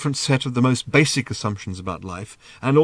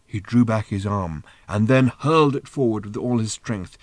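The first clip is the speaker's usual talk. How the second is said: plain reading voice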